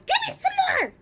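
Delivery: very high, loud crone's shriek; ecstatic cry. A narrator's reading. Grandma to George after the medicine, shrieking. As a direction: shriek with delight. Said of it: "she cried".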